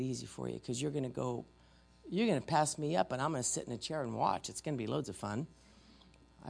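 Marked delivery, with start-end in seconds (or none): none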